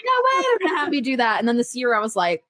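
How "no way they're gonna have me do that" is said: high-pitched incredulous retelling; mock-disbelieving squeal; laughing disbelief. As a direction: scoff at the very idea, amused and high. Retold disbelief, laughing as she speaks.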